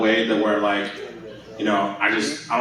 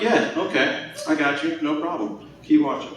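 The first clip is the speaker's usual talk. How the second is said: cocky reassurance; 6 semitones higher than usual. Retold or imitated. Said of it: "I'm like".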